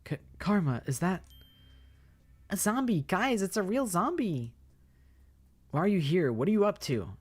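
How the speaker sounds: high, loud startled voices; spotting a real zombie; alarmed girls. Acted.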